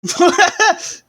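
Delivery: high burst of laughter; loud laugh. Real, not acted. Big laugh at the dinner joke.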